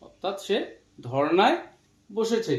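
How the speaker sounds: usual speaking voice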